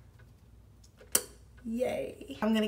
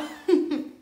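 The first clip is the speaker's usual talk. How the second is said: short airy laugh; quick breathy chuckle; 6 semitones higher, 4 dB louder than usual. Real, not acted. Laughs at her own joke.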